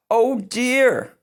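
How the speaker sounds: high, motherly oh dear; a concerned mother's sigh; mama's oh dear. Acted. Mama finding sick Sister in bed, concerned. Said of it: "gently pulled".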